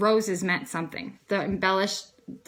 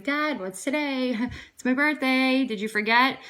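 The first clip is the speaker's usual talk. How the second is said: playful teasing; 5 semitones higher than usual. Retold or imitated. Daughter calling her father on her birthday, playful teasing.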